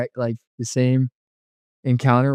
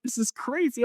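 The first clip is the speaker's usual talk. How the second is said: very high, softer exclamation; amazed excitement; 12 semitones higher, 7 dB quieter than usual. Retold or imitated. Friend reacting to his vision, amazed. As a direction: imitate an astonished friend, pitch way up.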